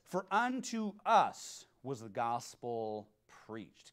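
slow, solemn verse reading; scripture reading. Acted. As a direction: read it like holy text, unhurried.

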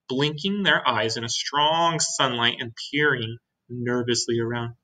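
usual speaking voice

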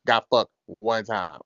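ordinary delivery

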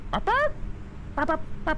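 high squeaky bark bark noises; mock chicken barks. Streamer squeaking fake chicken talk at the game's chicken. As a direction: pretend to speak chicken to the monster. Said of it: "I speak chicken".

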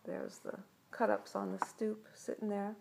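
plain reading voice

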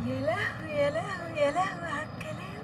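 tearful. Acted. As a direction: choke up with emotion. Moved by husband's loving words.

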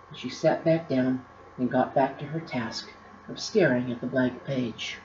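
plain reading voice

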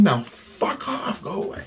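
drawn-out high plea, fading away; slow it down and let it thin out high; begging. Retold or imitated. Begging the alien to leave, high fading plea. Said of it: "And I'm begging".

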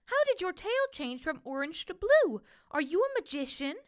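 high, marvelling little bird voice; a high bird voice asking in awe; enchanted bird. A narrator's reading. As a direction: wonder aloud like a charmed small bird.

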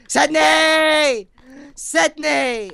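high, loud calls for help; imitated desperate calling. Replaying how he would have called Sydney from the hole, desperate calling. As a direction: call out from the bottom of a hole.

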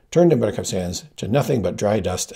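ordinary delivery

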